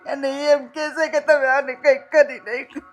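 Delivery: loud tearful outburst, voice breaking; crying. Real, not acted. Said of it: "નહીં, નહીં, બેન, રડોમાં". (રડતાં રડતાં, ઊંચા અવાજે)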